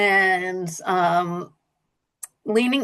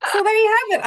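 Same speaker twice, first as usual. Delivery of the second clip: cat voice; 13 semitones higher, 4 dB louder, much faster than usual. Shown as a demonstration. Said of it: "I'm leaning into my cat voice".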